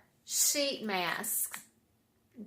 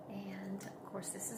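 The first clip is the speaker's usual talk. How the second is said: whispering; 2 semitones lower, 14 dB quieter than usual. Real, not acted. Unboxing aside.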